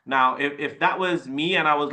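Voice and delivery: ordinary talk, nothing marked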